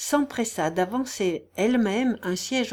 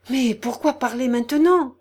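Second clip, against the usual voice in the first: raised, worried protest; increasingly frightened; 5 semitones higher, 7 dB louder than usual. A narrator's reading. General's wife hushing the sick man, frightened. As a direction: anxiously hush him. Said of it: "de plus en plus effrayée".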